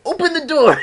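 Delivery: high, loud mock yell at the door; imitating a shout. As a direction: bang out the order like Sam. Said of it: "she's like".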